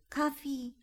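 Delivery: put-on a little girl mumbling half asleep; sleepy child murmur. A narrator's reading. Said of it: "murmelt Fee".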